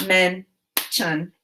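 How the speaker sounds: "Mention" split into two syllables with a long pause between; "Mention" broken as men... tion; chopped syllables. Shown as a demonstration. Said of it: "Now chop the syllables in mention".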